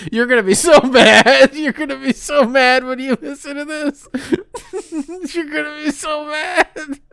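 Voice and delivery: high-pitched helpless laughter through the words; can barely get the words out for laughing; wheezing with laughter, voice squeaking high; laughing hard. Real, not acted. Cracking up reading Cooper's email.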